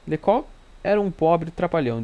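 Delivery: irritated. A narrator's reading. Holmes scorns a fictional detective to his friend, mildly irritated. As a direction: dismiss him with a touch of irritation. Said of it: "disse num tom irritado".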